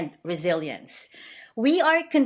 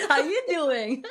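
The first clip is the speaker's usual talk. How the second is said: a light, laughing take on the Joey line, pitched higher; Joey from Friends; 7 semitones higher than usual. Retold or imitated. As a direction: toss off a playful Joey imitation through a laugh. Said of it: "Don't say that to Joey of Friends".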